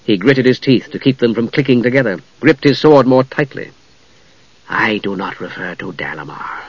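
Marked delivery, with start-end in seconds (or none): 4.7-6.6 low, soft spectral whisper; cold hissing layered whisper. A narrator's reading. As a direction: breathe it like a cold ghost. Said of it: "said the spectre".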